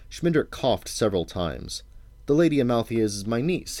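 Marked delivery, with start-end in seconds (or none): 2.3-3.8 higher voice, rising at the end; tentative offer. A narrator's reading. Wizard explaining the lady to the suspicious king, tentative offer. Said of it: "he offered".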